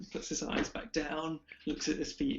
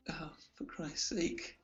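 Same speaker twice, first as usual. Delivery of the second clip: slow, softer whispered grumble; whispered exasperation; 9 dB quieter than usual. Real, not acted. GM losing his place in notes, whispering in exasperation. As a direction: whisper it to yourself, fed up.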